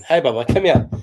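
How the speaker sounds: loud, high call to a dog. Real, not acted. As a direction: call out to a pet. Calling his dog.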